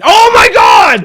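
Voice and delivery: high, loud, slow hammed-up scream; mock screaming reactor. Mocking other reactors, a hammed-up high loud scream. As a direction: parody an overexcited reactor. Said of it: "people are reacting".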